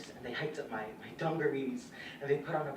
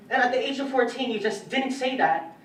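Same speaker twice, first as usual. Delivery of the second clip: loud and raised in pitch; emphatic insistence; 8 semitones higher, 9 dB louder than usual. Acted. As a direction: drive the point home forcefully. Stresses how shocking it was.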